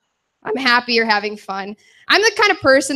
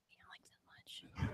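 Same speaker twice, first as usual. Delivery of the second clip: put-on hushed, breathy old lady reply; timid whisper; 30 dB quieter than usual. Elderly woman in the front row, whispering her answer. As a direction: barely voice it like a shy old woman.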